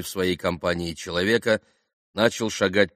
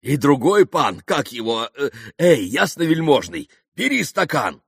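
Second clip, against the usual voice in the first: loud, high, fussing eagerness; bustling; 7 semitones higher, 7 dB louder than usual. A narrator's reading. Mitya hurrying the other gentleman to a glass, bustling. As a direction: fuss over the guests loudly. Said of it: "хлопотал Митя".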